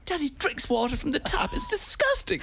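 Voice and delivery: put-on high, rising disgusted kid; child voice. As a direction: squeal at the end in disgust. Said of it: "I go".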